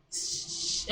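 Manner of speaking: "sh" as a soft voiceless hush; just breathe out a soft "sh"; SH sound demonstration. Shown as a demonstration. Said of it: "The, the X with H is sh".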